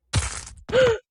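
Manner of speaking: sharp high frightened gasp; startled high gasp at the screen; scared gasp. Real, not acted. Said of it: "making very cringy noises".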